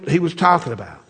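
normal talking voice

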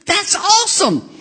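very high, gushing delight; high-pitched hammed-up enthusiasm; excited receptionist. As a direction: imitate her over-the-top thrill.

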